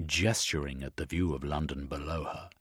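plain reading voice

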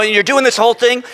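ordinary talk, nothing marked

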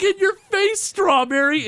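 high gleeful taunt; mock excited gloating. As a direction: crow it like a kid who won. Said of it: "he's so excited".

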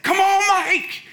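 far-off yell, high and drawn out; old coach cheering from the stands, slow and loud. Retold or imitated. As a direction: call out across a ball field.

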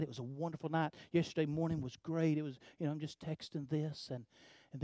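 low, very soft storytelling; quiet, confiding near-murmur; hushed retelling. Retold or imitated. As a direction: keep it down beside a sleeping child. Texting while the child sleeps.

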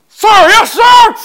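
high, loud barked "sir yes sir"; drill sergeant reply. Retold or imitated. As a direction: shout it like a recruit answering his drill sergeant. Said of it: "I pretend she's my drill sergeant".

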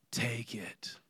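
whispered, breathless giving up; defeated whisper. Retold or imitated. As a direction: let the words die away to a whisper.

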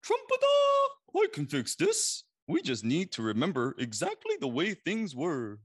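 very high, loud trumpeting elephant. A narrator's reading. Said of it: "The elephant knew just what to do".

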